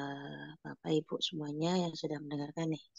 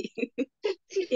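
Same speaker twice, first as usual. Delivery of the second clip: high laugh; 13 semitones higher than usual. Real, not acted. Laughing at greeting.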